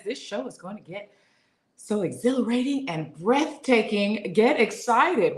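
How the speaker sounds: slow, loud fifties announcer; newscaster voice. Acted. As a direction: read it like a big old newscaster. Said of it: "What voice was that at the end?".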